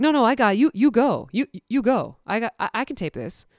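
lightly voiced friend pushing to take over; mock insistent coworker. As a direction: overeager helper, lightly played. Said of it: "And she's like".

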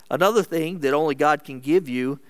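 ordinary talk

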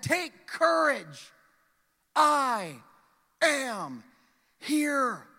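slow and loud, each word spaced; bold proclamation. Acted. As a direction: announce it with full strength. Jesus' words proclaimed.

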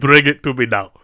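mock Russian accent demand. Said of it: "talking in a Russian accent".

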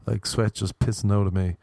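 usual speaking voice

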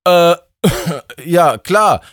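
high startled voice with a cough; Justus caught off guard. A narrator's reading. Justus startled by god Justin's question, high and flustered. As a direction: stammer back, caught napping. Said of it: "sagte Justus".

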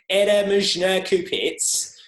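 loud, high, slow Russian in broad Australian; awful Australian-accented Russian. Shown as a demonstration. Drunk gamer calling out over the mic, loud Australian-accented Russian. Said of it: "my awful Australian accent comes out".

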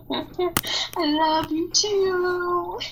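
high, drawn-out singsong tease; teasing affection. Pretend. Sister teases back after being scolded, mock-sweet affection.

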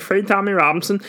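high-pitched put-on slogan; mock slogan voice. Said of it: "'cause I said".

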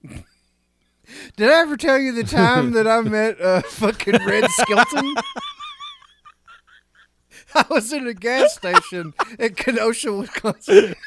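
real helpless laughter. Real, not acted. Podcast host derailing the show with a random story, cracking up. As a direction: struggle to get the tangent out through giggles. Cracking up over sudden tangent.

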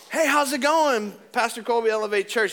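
fake friendly. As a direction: greet her with an over-sweet pastor cheer. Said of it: "And I'm like".

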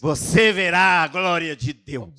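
slow, loud, ringing proclamation; preaching loud. Real, not acted. Preacher proclaiming to the church, loud and slow. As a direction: hammer the promise home to the crowd. Preacher repeats promise to the crowd.

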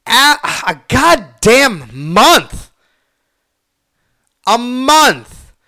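stressing "month" with fed-up outrage; exasperated emphasis. Real, not acted. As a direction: hammer home "a goddamn month" in disbelief.

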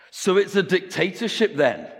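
high, loud incredulous question; challenging coach. Acted. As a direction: challenge it as the coach, pitched well up. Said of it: "the coach asks".

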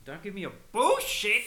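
shouting match. Acted. Heated mock argument over Batman.

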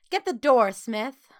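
loud, high command that drops on the name; stern order. A narrator's reading. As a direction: order the girl about. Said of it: "ordered a frowning woman".

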